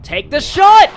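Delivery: loud, high cheering; excited shout. Real, not acted. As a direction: yell with joy after scoring. Scores a goal in game.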